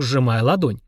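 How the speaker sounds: ordinary delivery, nothing marked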